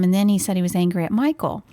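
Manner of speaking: ordinary talk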